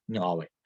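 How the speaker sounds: ñove with a nasal velar start and open O; a foreign-sounding ñove; mock native ñove. Shown as a demonstration. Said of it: "pronuncia así, con la nasal velar".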